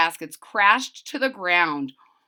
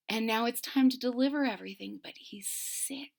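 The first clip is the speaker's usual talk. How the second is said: whisper; 7 dB quieter, faster than usual. Acted.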